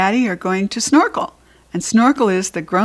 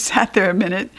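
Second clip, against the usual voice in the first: plain reading voice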